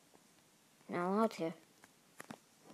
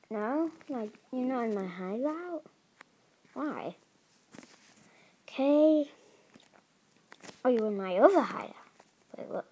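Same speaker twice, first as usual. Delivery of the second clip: ordinary talk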